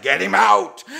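high, loud mock demand to throw him out; loud high mock eviction order; mock shout from school. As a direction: bark it like the school wants him gone. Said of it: "high school was like".

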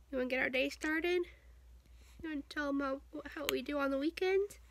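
high cooing questions to an infant. Real, not acted. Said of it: "talk to the baby with my mommy baby voice".

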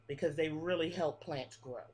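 usual speaking voice

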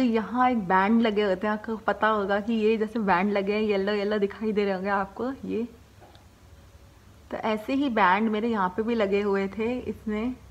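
talking with finger on teeth; lip held down by finger. Real, not acted. Seen 2: Finger hooks lower lip down while speaking, from 0.5 on.

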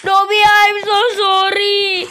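slow, wailing fake sob; hammed-up grief, drawn out; mock crying. Mourning the dead panda with slow, mock wailing.

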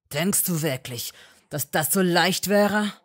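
loud, rising anger; angry challenge. A narrator's reading. Malfoy angrily challenging Hermine. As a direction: throw it at her.

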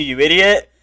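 usual speaking voice

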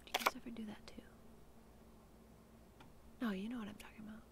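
soft unvoiced whisper. Real, not acted. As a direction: breathe it out in a secret whisper. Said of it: "I'm whispering".

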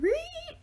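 long, very high boar squeal. A narrator's reading. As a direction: squeal like a giant pig. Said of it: "The boar said".

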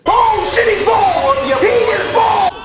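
high, loud outburst of disbelief; shocked shout. Real, not acted. Reacting to seeing that he is bald, shocked shouting. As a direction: yell it in amused shock.

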